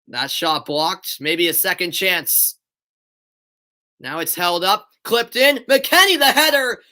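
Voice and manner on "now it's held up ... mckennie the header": rising to a loud, high shout at the header; excited. Real, not acted. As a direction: call the chance as it builds. Chance in the box.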